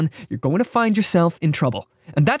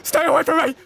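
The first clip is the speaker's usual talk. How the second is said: high, panicked squeal of a victim; hammed-up scared victim voice; mock terrified plea; 13 semitones higher than usual. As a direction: beg him to stay away, way up high. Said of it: "he's just like".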